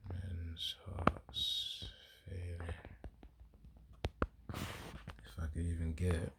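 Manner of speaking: very soft, to oneself while searching; absent self-talk. Real, not acted. Preacher hunting for the men's hearts verse, quiet self-talk. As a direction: think aloud under your breath.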